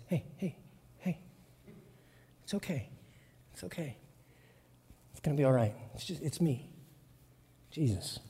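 near-whispered, gentle comfort; soft reassurance. Acted. Jesus comforting scared disciples in a soft hush. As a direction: hush them tenderly.

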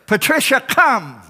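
high hollered call by name; shepherd calling sheep. Acted. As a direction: call a straying sheep in from afar. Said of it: "the shepherd comes to the sheepfold and says".

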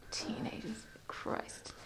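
barely voiced, exasperated whisper; retold thought under the breath; whispered inner groan. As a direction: whisper it to yourself. So I think to myself.

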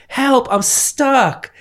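high, very slow parody plea; mock damsel in distress. Lady calls to her plumber, mock helpless. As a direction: stretch out a hammed-up call for help.

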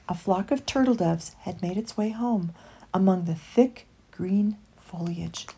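plain reading voice

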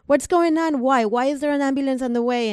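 a hint of the sister's panic, rushed; panicked questions. Sister frightened to hear an ambulance is coming, high rushed panic.

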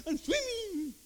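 put-on high, childlike boast; a little kid at the pool boasts, high and proud. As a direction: crow like a kid who thinks he swims. Child pretending to swim.

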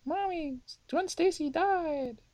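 put-on high, slow little-kid voice; a small child breaking bad news to mom. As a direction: tell mommy the sad news like a small child. Kid telling parent about death.

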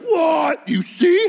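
very high, hammed-up disbelief; astonished shout. Crowd hearing the blind man sees, mock astonished shout. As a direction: shriek it in mock amazement.

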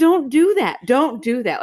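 high, loud mock yell at the TV. Telling the TV characters off, mock yell. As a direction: shout it at the screen, half joking. Yelling at the TV show.

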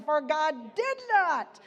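loud, emphatic preaching of the verse; preacher thunders Timothy's verse at the church; fiery preaching. Acted. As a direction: proclaim it to the congregation with force. Preaching scripture at full force.

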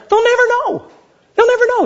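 high, sly mock glee at the tire prank; sneaky. A preacher playing his petty self, gloating high and sly.

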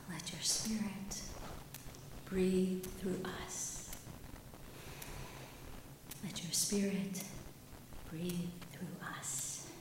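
slow, soft breathed prayer. Shown as a demonstration. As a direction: breathe the words out slowly. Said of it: "we begin by our inhale saying".

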